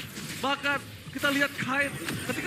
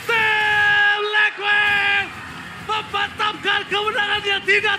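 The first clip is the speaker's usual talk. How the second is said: shouted game-winning hype call; fighting game announcer; 5 semitones higher, 5 dB louder, slower than usual. Real, not acted. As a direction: roar the final teamfight.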